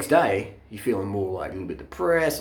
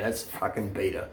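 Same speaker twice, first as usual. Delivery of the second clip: ordinary talk, nothing marked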